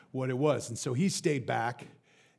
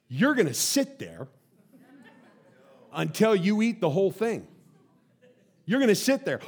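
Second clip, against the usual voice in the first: raised voice, laying down the law; stern parent imitation; 5 semitones higher, 6 dB louder than usual. Parent to child at the dinner table, stern imitation. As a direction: play a parent issuing an ultimatum.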